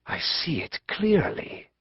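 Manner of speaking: hushed, breathless whisper; a prophet whispering to the Tsarina in awe; awed whisper. Acted. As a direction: whisper it as if seeing the vision.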